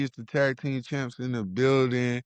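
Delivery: ordinary delivery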